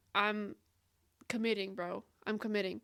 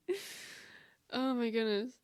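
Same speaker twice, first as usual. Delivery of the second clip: usual speaking voice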